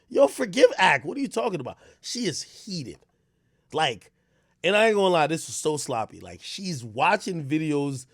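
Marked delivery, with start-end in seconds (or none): 0.1-1.8 higher, louder imitation of a follower; protesting follower. His followers defending him, mock protest. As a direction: jump in as an indignant fan, lightly acted.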